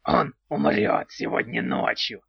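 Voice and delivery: calm goblin stating death; cold-blooded goblin. A narrator's reading. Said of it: "хладнокровно отвечал Антигон".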